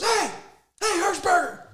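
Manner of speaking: high, loud imitated bellow; angry coach yell. Player imitating coach scolding rival Hirschberger, angry protective shouting. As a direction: yell across the field to defend your players.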